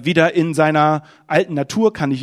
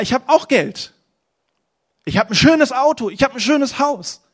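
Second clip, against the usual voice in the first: loud, impassioned sermon voice, pitched higher; fiery preaching; 7 semitones higher, 5 dB louder than usual. Acted. Preacher confessing his craving for recognition to the church, loud and fervent. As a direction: preach it out to the congregation.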